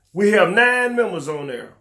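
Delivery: ordinary talk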